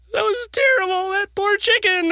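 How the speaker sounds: put-on high, whiny little boy voice; imitating upset young son. Dad retelling his son's upset cry, in a high little-boy voice. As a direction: mimic a distraught nine-year-old. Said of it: "he was all upset".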